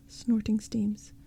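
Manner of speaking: plain reading voice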